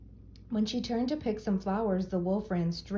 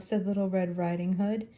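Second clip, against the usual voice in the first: plain reading voice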